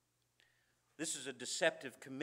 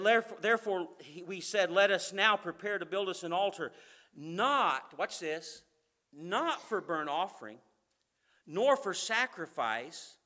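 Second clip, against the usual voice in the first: preaching scripture; 9 dB louder than usual. Acted. Preacher reading Matthew to the congregation, loud and proclaiming. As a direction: proclaim every word to the room. Preacher reads Joshua passage aloud.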